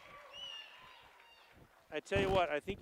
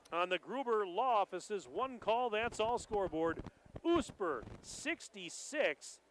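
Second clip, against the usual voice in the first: ordinary talk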